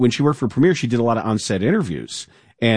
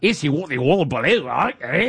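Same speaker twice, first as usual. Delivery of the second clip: mock Australian slang; 3 semitones higher than usual. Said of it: "using the weird slang".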